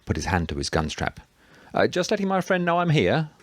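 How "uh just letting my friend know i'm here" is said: high-pitched call across to someone. A narrator's reading. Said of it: "Thomas called out to him".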